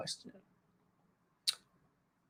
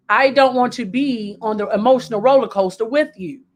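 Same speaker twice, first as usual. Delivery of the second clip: ordinary talk, nothing marked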